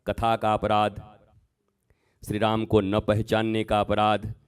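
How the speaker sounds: normal talking voice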